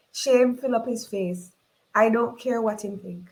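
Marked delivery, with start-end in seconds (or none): none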